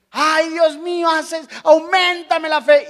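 clamor lento y más agudo, imitando; súplica exagerada. Retold or imitated. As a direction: ruega con dramatismo fingido. Oración llorosa imitada. (súplica teatral, lenta y aguda)

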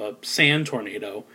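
ordinary talk

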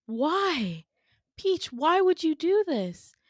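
high, drawn wail of betrayal; tearful plea. Retold or imitated. Winter in tears confronting Peach, heartbroken pleading. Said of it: "Winter just has tears streaming down her face".

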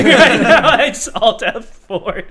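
cracking up while retelling the game; laughing. Real, not acted. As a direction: laugh through the words at the absurdity. Laughing at own game story.

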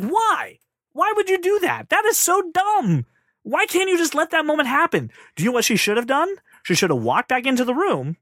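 high-pitched, incredulous outburst; comically aggrieved, voice shooting up; squeaky exasperation, stretching the key words; exasperated rant. Real, not acted.

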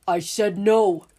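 slow, loud, furious repeat; angry shout. Acted. Father refusing the men again, slow angry shout. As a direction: bite down on every word, loud and slow.

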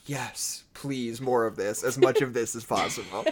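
mock aroused. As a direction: savour it softly, as if turned on. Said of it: "you need to take a cold shower".

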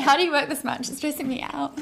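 high pitched disbelief; stunned at her workload; incredulous. Retold or imitated. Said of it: "I was like".

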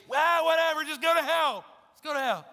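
high, loud, dismissive yell; hammed-up careless outburst; mock callous shout. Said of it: "that's, that's the attitude".

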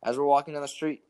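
usual speaking voice

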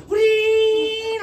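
high, loud drawn-out whee; cartoon whee. Cartoon character going off a mountainside, high whee. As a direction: squeal like a cartoon runner off a cliff. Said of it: "You know them cartoon characters?".

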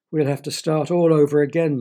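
loud, complaining moan. A narrator's reading. Pilot facing a second rescue, moaning. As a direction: moan it out in exasperation. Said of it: "moaned the pilot".

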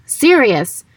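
half-voiced shout within narration; Harry's read-aloud yell. A narrator's reading. Harry calling for his fallen godfather, restrained yell. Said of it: "yelled Harry".

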